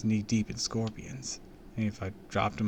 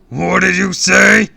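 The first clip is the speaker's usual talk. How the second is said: high, loud, slow villain voice; a furious superhero-villain demanding an answer; villain's menace; 10 semitones higher, 18 dB louder, slower than usual. A narrator's reading. Villain reacts to insults.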